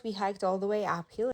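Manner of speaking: normal talking voice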